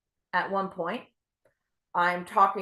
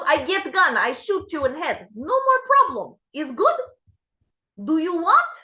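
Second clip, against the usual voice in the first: put-on higher, louder Ukrainian accent, cheerfully offering; 7 semitones higher, 5 dB louder than usual. Therapist brightly offers to shoot the patient, Ukrainian accent. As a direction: sell the shooting as a helpful fix, Ukrainian accent. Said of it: "she has an accent like this".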